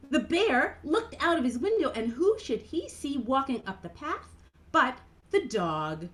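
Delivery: plain reading voice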